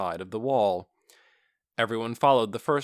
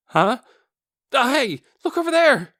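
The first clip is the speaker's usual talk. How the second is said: sudden high startled shout, louder; startled cry; 14 semitones higher, 10 dB louder than usual. A narrator's reading. A guard spotting figures in the graveyard, startled shout. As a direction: yell it out as you spot them.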